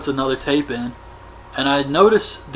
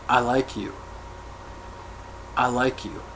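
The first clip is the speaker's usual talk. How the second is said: put-on creepy girl; 2 semitones lower, 10 dB quieter than usual. Weird girl talks to the guy with glasses, quietly creepy. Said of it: "was just really creepy".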